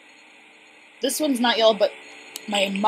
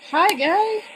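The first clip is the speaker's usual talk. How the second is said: bright greeting; 10 semitones higher, 6 dB louder than usual. Pretend. Greeting a game character.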